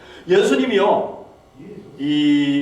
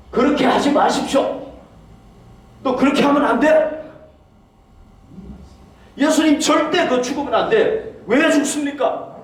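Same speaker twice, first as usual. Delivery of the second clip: pleading, raised rebuke to his teacher; Peter rebuking; 8 semitones higher than usual. Retold or imitated. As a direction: protest high and urgent, like a worried disciple. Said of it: "뭐라고 책망을 한 거예요?". (예수님을 말리며 높은 목소리로 책망하는 베드로)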